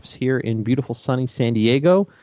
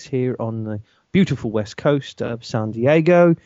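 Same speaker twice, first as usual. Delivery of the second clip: ordinary talk, nothing marked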